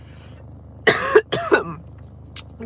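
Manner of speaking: a long, harsh cough from a cold; real cough; hacking cough. Real, not acted. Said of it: "for my body to let me do things".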